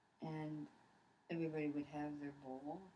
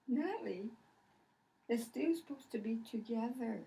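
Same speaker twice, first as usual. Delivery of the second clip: mock exasperated parent; 7 semitones higher, 6 dB louder than usual. Mother scolding child about stew.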